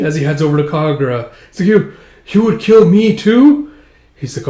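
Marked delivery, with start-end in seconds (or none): 1.7-3.7 high, loud, slow question; acted fearful shock; alarmed disbelief. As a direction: ask in dawning horror, voice raised. Victim realises she will die.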